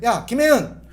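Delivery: loud, high, mocking yell of the child's name; harsh yell. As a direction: bark the name like a fed-up mom. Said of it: "애를 부를 때는".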